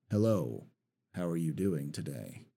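same greeting dropped low and quiet. Shown as a demonstration. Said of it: "two different characters right off the bat because of the tone".

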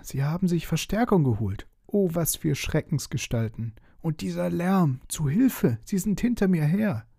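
scared dragon fleeing, voice pitched high; panicked. Acted. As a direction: cry out as if hunted.

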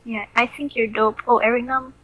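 usual speaking voice

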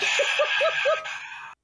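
squealing wheeze of a laugh; high laugh. Real, not acted. Laughing at the joke.

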